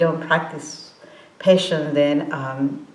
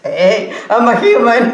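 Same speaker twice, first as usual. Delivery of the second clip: loud laughing protest; 6 semitones higher, 8 dB louder than usual. Retold or imitated. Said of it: "I was angry".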